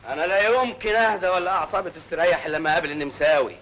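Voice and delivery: ordinary delivery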